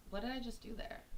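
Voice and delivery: soft, under the breath; quiet mock puzzlement. Streamer noticing what she did, soft mock puzzlement. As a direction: wonder softly to yourself. Teasing reveal of squirrel background.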